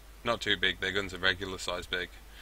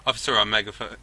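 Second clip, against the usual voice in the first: ordinary talk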